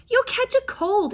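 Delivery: high fussy warning; warning aunt. A narrator's reading. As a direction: warn her like a worried aunt. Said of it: "Aunt Patsy warned".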